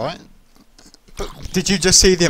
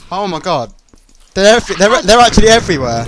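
alarmed; 6 semitones higher, 9 dB louder than usual. Real, not acted. Spider ambush in game.

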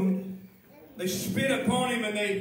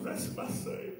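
hushed repeat; 9 semitones higher, 5 dB quieter than usual. Acted.

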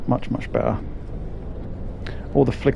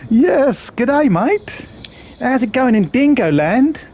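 mock Australian; 9 semitones higher, much slower than usual. Greets Australian viewer in chat.

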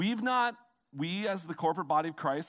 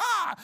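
sharp high mock scream of frustration; exasperated scream; 6 dB louder than usual. Frazzled parent fleeing a house full of babies, mock scream. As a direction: let out a fed-up parent's yell. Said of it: "It's like".

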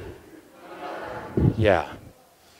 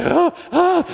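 high startled oh, oh; 11 semitones higher than usual. Retold or imitated. Soldier startled mid salute, high surprised gasp. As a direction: caught off guard, voice jumps high. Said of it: "He's like".

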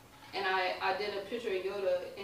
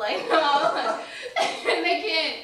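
high laughing words, much louder; 7 semitones higher, 10 dB louder, much slower than usual. Real, not acted. Mocking shy nerdy customers, laughing.

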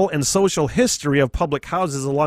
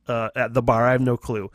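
ordinary talk

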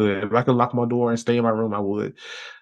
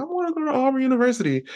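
much higher, fast and eager; eager teen; 14 semitones higher, much faster than usual. Retold or imitated. Teen telling mom about his college dream, high and eager. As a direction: blurt it out like an excited kid.

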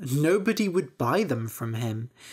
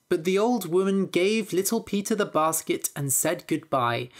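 ordinary delivery, nothing marked

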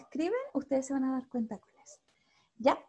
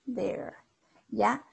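ordinary talk, nothing marked

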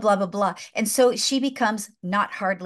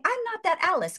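put-on pitched-up girlish Alice; Alice insisting, raised voice; high Alice voice; 10 semitones higher than usual. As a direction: play Alice small and high.

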